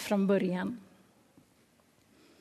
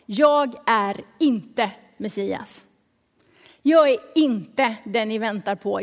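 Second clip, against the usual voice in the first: slow, deliberate stress on "inte"; super-clear; 4 dB louder, slower than usual. Retold or imitated. John answers those asking who he is, slow emphatic "inte". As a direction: spell it out with weight on "inte". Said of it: "han är supertydlig över en enda sak".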